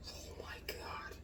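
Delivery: slow breathless whisper of delight; awed whisper. Real, not acted. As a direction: whisper it as if you can't believe the taste. Stunned by the taco taste.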